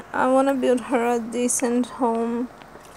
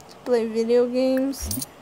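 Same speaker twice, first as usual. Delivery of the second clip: usual speaking voice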